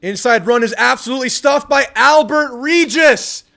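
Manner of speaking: loud, high, thrilled play call; excited shout. Real, not acted. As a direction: yell the stop like a fan. Excited reaction to a stuff.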